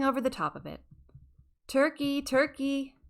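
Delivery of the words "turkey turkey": sugary coaxing call, higher; lightly sweetened cooing call; sweetest voice. A narrator's reading. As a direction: coax him sweetly like luring a pet. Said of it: "in his sweetest voice".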